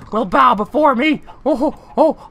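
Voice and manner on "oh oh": usual speaking voice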